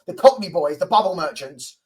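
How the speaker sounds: ordinary talk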